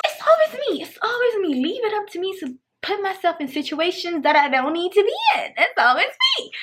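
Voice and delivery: high, hammed-up lament; self-pitying wail. Storyteller bemoaning always landing in bad situations, high mock wail. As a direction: whine it high as if cursing your luck. Bemoaning her own luck.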